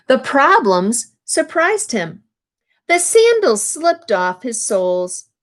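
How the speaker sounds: storybook read-aloud, lively phrasing; picture-book narration; reading to children. A narrator's reading. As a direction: read the page to the class.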